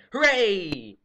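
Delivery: normal talking voice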